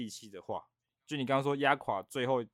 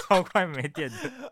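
laughing; 6 semitones higher, 4 dB louder, slower than usual. Real, not acted. Laughing punchline.

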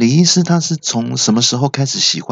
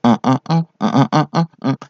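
short hummed toots stepping up in pitch; trumpet-like fart notes; 3 semitones higher than usual. Acted. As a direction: imitate a little trumpet in staccato grunts. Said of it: "有点像吹喇叭的感觉". (模仿吹喇叭般分音高的短屁声)

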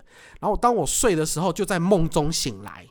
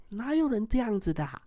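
lightly voiced woman, higher and a bit softer; a hint of a sulky female ghost; ghost woman's voice; 7 semitones higher, 4 dB quieter than usual. The hidden ghost woman complaining to him, lightly voiced. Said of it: "就有一个女生的声音说".

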